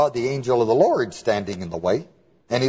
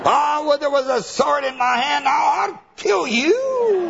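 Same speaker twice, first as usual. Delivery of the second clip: much higher, laughing hammed-up shout; mock threat. Balaam threatening his donkey with a mock shout. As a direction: rage at the donkey, then slow down on the kill.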